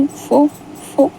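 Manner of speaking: choked up, breaking into tears; stumbling words through a sob; Wing tearing up as Wang Ji confesses, choked crying. Acted.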